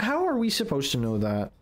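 normal talking voice

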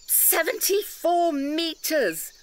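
slow, high, drawn-out outrage; indignant emphasis. Acted. Bell ringer stressing the figure to the railway workers, slow and indignant. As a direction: spell out the number slowly in disbelief.

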